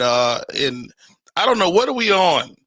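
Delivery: usual speaking voice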